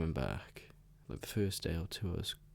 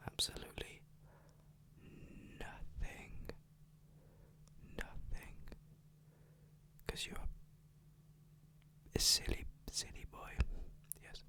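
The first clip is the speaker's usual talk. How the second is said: teasing whisper; 9 dB quieter than usual. Real, not acted. Whispered taunt at brother.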